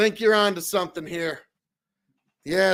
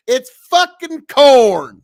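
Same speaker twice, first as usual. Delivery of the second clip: "It's fucking corn" slow, loud, higher, drawn out; Nebraska anger drawl; 7 semitones higher, 10 dB louder, slower than usual. Shown as a demonstration. Streamer showing the right way, loud angry drawl. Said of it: "there you go".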